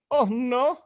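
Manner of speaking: ordinary delivery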